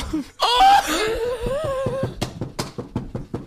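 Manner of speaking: high wailing laughter; shrieking laugh. Real, not acted. Co-host cracking up at the host's joke, shrieking laugh. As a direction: howl with laughter.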